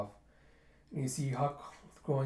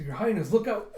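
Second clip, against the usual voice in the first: urgent warning; 8 semitones higher, 8 dB louder than usual. Acted. Man warning princess about to trip, urgent shout. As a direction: voice jumping higher and louder. Warning before she trips.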